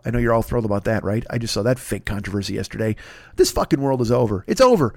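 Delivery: ordinary talk, nothing marked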